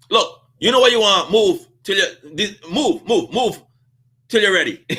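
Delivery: busy cashier clearing the line; impatient shooing. Retold or imitated. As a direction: wave him out of the way. Cashier lady shoos him aside.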